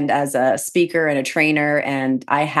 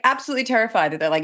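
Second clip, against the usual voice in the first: ordinary talk, nothing marked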